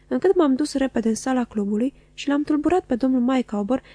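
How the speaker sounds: ordinary delivery, nothing marked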